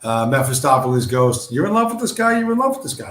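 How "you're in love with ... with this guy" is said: "love" sung much higher, teasing; mocking taunt. Real, not acted. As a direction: tease them in a high singsong. Taunting viewers about their idol.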